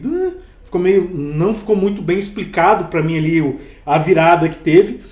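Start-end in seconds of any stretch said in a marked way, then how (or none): 0.0-0.3 puzzled high "Hã?"; a baffled, rising huh; confused huh. Retold or imitated. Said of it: "o final ter ficado meio".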